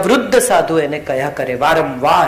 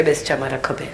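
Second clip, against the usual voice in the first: quiet; 5 semitones lower, 8 dB quieter than usual. Acted. Kabir offers to carry wife.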